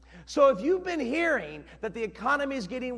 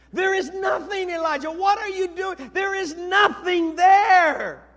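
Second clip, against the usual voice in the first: high, loud complaint building to a shout; exasperated protest; 7 semitones higher, 8 dB louder than usual. Acted. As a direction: protest loudly, stretching the final words. Exasperated servant protesting to Elijah.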